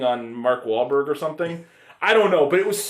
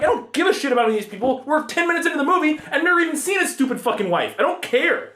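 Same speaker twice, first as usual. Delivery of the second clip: higher, fast, fed-up rant; fast exasperated rant; 10 semitones higher, faster than usual. Retold or imitated. Ranting about the movie's wife scene, fast and fed up. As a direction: rattle off your disbelief quickly. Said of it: "I'm like".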